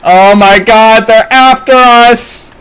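high, loud yell building upward; alarmed shout. Real, not acted. Streamer being chased by enemies, alarmed shout.

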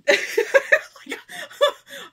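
high, loud overwhelmed laugh; real emotional burst of laughter; near-tears laugh. Real, not acted. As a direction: laugh as if about to cry. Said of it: "I'm almost crying here".

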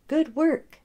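high little pat-on-the-head praise; praise to pet. Acted. Wilfred praising his moose, high and pleased. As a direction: praise him like a proud pet owner.

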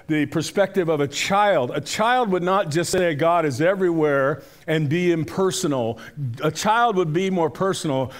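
ordinary delivery

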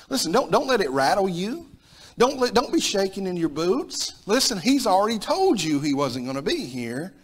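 urgent reassurance. Acted. Preacher urging the church not to fear, high and loud. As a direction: urge them not to be shaken.

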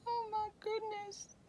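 high, gushing oh my goodness; breathy delight at a cute find; delighted gasp. Real, not acted. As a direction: swoon over something adorable. Gushing over a craft item.

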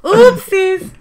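laughing oopsies; high, loud giggly oops. Real, not acted. Mishap, laughing.

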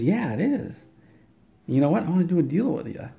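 high-pitched patient voice; eager patient. Acted. Patient offers the unlicensed surgeon a deal, eager.

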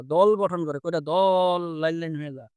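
normal talking voice

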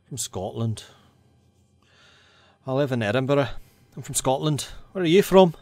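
put-on a tentative Scottish accent that drifts toward northern English. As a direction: try out a Scottish accent on the spot, half-sure. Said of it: "Can I do a Scottish accent?".

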